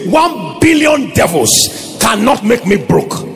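slow, defiant declaration. Acted. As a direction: declare it slowly and firmly. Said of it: "God's servant will put it this way".

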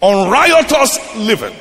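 slow, high, ringing scripture declamation; emphatic preaching. Real, not acted. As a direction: hammer the verse home, word by word. Preacher hammers home repeated verse.

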